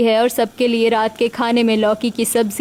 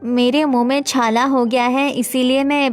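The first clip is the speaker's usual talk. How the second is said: slightly raised character voice, understated; gently put-on daughter-in-law voice; lightly voiced Manisha. Voices Manisha explaining her mouth ulcer.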